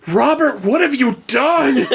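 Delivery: high, slow dismayed teacher voice; shocked teacher. Retold or imitated. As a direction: scold him in disbelief. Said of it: "She's like".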